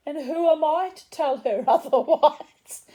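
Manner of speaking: high, loud, laughing through the words; laughing protest. Retold or imitated. Retold answer with laughter.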